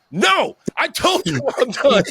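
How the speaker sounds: high, drawn-out protest; mock-exasperated, pitch way up; exasperated refusal. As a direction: imitate a worn-out friend digging his heels in. Buddy refuses to go on.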